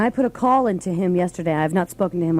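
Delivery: ordinary talk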